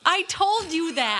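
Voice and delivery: exasperated I-told-you; indignant protest. Real, not acted. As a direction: protest it slowly, pitch jumping up. Mock quarrel over movie.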